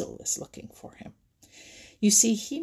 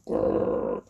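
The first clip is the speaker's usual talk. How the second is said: low breathy growl; rough animal growl; bear growl. A narrator's reading. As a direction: end the threat with a growl. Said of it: "growled Buster".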